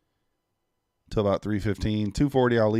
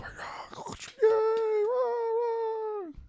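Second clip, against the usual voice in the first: breathy hissing applause noise made with the mouth; imitated applause; 3 dB quieter than usual. Shown as a demonstration. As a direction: fake a crowd clapping with a whispered rush. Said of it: "my best imitation of a round of applause".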